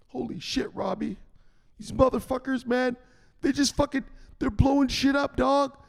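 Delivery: high, panicked rising voice; freaking out in a high pitch; panicky Zelensky. Retold or imitated. Zelensky panicking to Robbie, high frantic voice.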